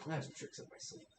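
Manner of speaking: Elroy whispering to the heroes, sly and amused. Acted. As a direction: whisper it with a wink. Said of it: "he kinda winks".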